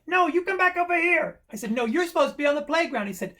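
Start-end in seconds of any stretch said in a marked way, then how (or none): 0.1-1.4 put-on high, loud defiant kid's shout; yelling back. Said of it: "Davis yells back". 1.7-3.1 higher-pitched insistent teacher; calling back. Retold or imitated. Acted shouting match across street.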